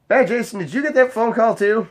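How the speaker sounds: coworker voice. Retold or imitated. Telling the story of his coworker asking him. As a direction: play the chatty coworker, a bit up.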